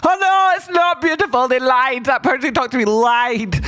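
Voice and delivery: cartoonish panicked victim; mock panic. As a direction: squeal like a duped buyer. Imitating panicking buyers.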